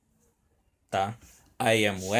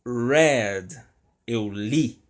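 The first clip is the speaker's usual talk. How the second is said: ordinary delivery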